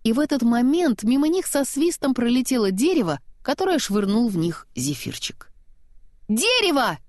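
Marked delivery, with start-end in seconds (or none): 6.3-7.0 shouting. A narrator's reading. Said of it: "крикнула Анна".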